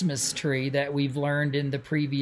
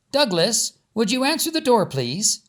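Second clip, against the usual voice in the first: higher, sweet grandmother asking a favour; Granny asking; 6 semitones higher than usual. A narrator's reading. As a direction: lift the voice like a kindly granny calling her grandson. Granny asks Doug.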